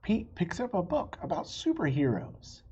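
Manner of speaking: gentle storybook reading voice; story narration. A narrator's reading. Reading a picture book to young listeners, gentle narration. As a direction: read it like bedtime story time.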